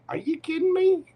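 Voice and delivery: can't believe the lucky draw; a squeal of happy surprise; delighted disbelief. Real, not acted. Reacting to the Sun card.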